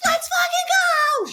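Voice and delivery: high, slow victory scream; retold finish-line scream, high and drawn out. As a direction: scream it in the medal lady's face.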